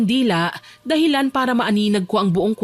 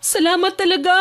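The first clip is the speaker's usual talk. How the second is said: higher-pitched character voice, thankful; grateful Alona; 9 semitones higher than usual. Acted. Trapped Alona thanks her kuya, gratefully.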